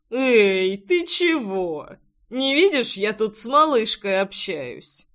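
slurring a little, tipsy. Acted. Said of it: "Слегка пьяным голосом возразил парень".

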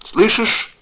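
high, loud question; sharply rising call. A narrator's reading. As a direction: check anxiously that the line holds. Man checking the line, calling out.

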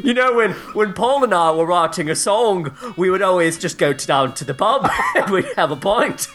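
put-on chatty, amused British accent; British musician accent. Musician recalling writing songs with Paul, British accent.

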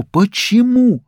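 put-on high, slow child's cry; bewildered cry. A narrator's reading. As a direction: cry out in disbelief. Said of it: "У него вырвался крик".

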